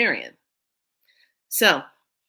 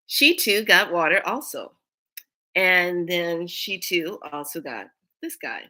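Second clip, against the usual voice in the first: ordinary talk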